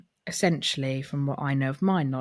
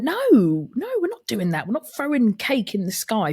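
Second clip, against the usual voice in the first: sharp raised telling-off, high and loud; firm loud no; 7 semitones higher, 5 dB louder than usual. Retold or imitated. Auntie refusing nephew's cake-throwing idea, loud and firm. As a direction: shut the idea down fast like a strict auntie.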